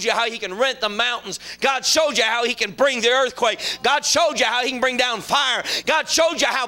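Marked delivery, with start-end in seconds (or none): none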